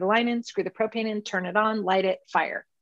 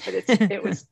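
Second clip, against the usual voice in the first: ordinary talk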